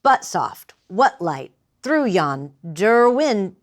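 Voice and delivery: slow, unnatural "through yon Derwin", breaking yonder window apart; against iambic rhythm. Shown as a demonstration. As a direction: force "yon Derwin" out against its natural da-DUM beat. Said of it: "tried not to use an iambic rhythm".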